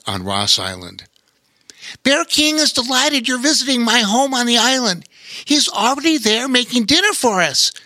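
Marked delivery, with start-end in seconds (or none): 2.0-7.7 friendly sailor voice. Acted. Bobo Chico character voice.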